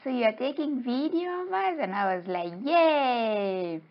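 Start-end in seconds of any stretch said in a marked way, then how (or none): 0.0-1.8 high little-fish voice, questioning; raised pitch, perky questioning; little guys. Acted. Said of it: "those little guys, they were like". 2.6-3.8 high, drawn-out cheer; mock-excited little hooray; acted cheer.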